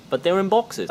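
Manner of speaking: put-on a little kid dragging out the words; curious kid. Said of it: "kid voice".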